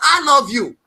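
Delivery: sudden loud I love you; loud declaration. Real, not acted. Shouting her love across the table. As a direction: blurt it out with feeling.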